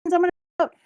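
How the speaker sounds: high, fast, loud excited Luffy voice; mock Luffy. Fan mimicking hero rushing to his friends, high and fast. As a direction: rattle it off like an eager hero. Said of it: "and he's like".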